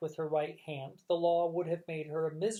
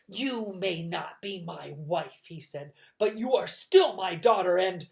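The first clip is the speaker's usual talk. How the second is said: slow, then louder menacing villain; 7 dB louder than usual. A narrator's reading. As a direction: threaten her slowly.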